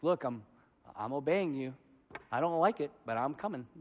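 low, soft grudging mutter; grudging obedience. Acted. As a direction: quietly tell God you are complying. Grudging believer talking to God.

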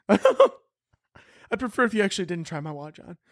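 high-pitched hesitant watch owner; mock polite refusal. Watch owner to would-be thief, high mock-polite refusal. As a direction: decline politely, voice lifted.

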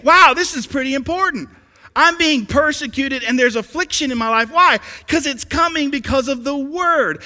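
loud, high, impassioned sermon; full-force preaching. Real, not acted. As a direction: raise the voice and drive it home to the congregation.

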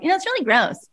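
high, sneering take on a critic; mock disgust. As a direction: pitch it up and sneer the complaint. Mocking a critic's complaint.

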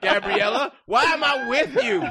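high, loud hammed-up rant; mock outburst. Buyer venting at girlfriend Gabriella in a loud, high mock rant. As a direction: rant at her in mock disbelief, laughing.

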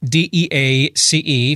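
D E A C E spelled letter by letter, slowly; spelling it out. Shown as a demonstration. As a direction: spell D E A C E one letter at a time. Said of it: "the last name is spelled".

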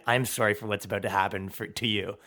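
mock apology. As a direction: rush a hushed sheepish sorry.

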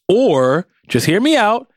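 lightly voiced eager kid; hopeful pitch. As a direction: float the idea up eagerly. Voicing eager teen pitching her idea.

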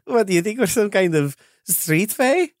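high, indignant fae voice; offended fae. Acted. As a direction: bristle at the insult. Fae character takes offence.

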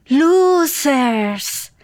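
drawn-out mock "losers". Mocking the heroes as losers. (burlón, alargando la palabra)

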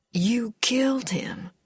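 slow, whispery disbelief; stunned hush. Acted. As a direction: ask it slowly, barely voiced. Stunned man asks about the killing.

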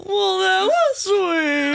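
slow, lilting ladylike line; drawn-out, dainty "that was sweet"; prim lady phrase. Retold or imitated. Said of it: "You don't go".

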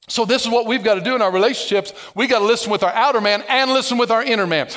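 usual speaking voice

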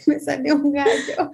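laughing through the words; giggling while explaining. Real, not acted. Laughing after voice crack.

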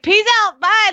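put-on high breezy goodbye of a kid; carefree kid. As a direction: toss it off like a kid who doesn't care. Son casually leaving, quoted.

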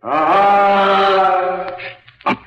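high, sung-out aha, gleeful; delighted exclaim. Acted. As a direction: crow over the discovery. Delighted at the discovery.

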